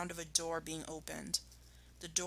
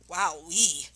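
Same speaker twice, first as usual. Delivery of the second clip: gasping; 4 semitones higher than usual. Acted. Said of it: "he gasped".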